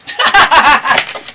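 breathy laugh at herself; airy laugh. Real, not acted. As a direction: laugh at your own story. Laughing at her own story.

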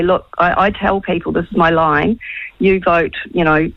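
ordinary talk, nothing marked

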